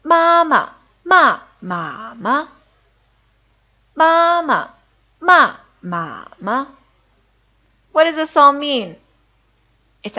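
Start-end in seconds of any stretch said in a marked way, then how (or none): 0.0-6.7 slow, high, exact Mandarin tones; read-along demo. Shown as a demonstration. Teacher leading learners through a tone practice sentence, slow read-along. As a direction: model each tone slowly for learners to echo. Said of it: "try reading along with me".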